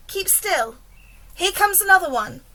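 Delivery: ordinary delivery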